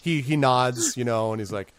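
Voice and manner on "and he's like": ordinary delivery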